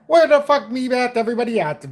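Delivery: high, loud hammed-up Irish brogue; a clumsy Irish impression that comes out as a killer leprechaun; loud mock Irish accent; bad Irish accent. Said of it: "the only way I can talk like that in the Irish sense".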